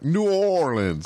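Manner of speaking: slow high Cajun drawl on New Orleans; Cajun accent. Retold or imitated. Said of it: "I'm trying to do my Cajun".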